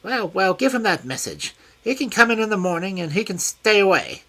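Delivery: high, loud, fussy complaint; a peevish scholar snapping at his butler; high piercing petulant man. A narrator's reading. Smith dismissing Watson's message.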